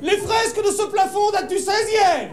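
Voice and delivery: high-pitched, projected tour-guide announcement; guide's declaiming. Acted. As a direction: declaim it high and slow to the group. Guide's lecture, visitor shouts in.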